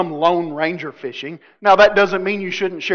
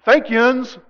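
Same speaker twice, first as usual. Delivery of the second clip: imitating Tennesseans; 5 semitones higher, 3 dB louder than usual. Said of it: "You Tennesseans are weirdos".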